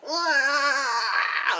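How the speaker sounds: high, drawn-out mock wail; hammed-up "waah" cry; imitated baby wail.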